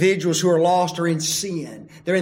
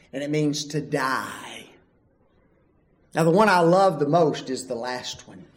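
ordinary delivery

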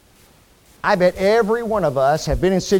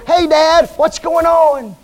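put-on bright high child's call to dad, voice way up and louder; cheerful child; 10 semitones higher, 8 dB louder than usual. Child calling out to dad after a spanking, high and carefree. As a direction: pop up as a kid with no fear at all.

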